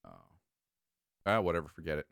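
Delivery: ordinary talk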